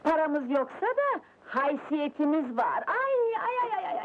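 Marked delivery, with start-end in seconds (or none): 2.9-4.0 wailing lament. Acted.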